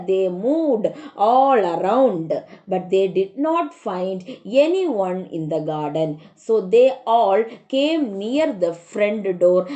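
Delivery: ordinary talk, nothing marked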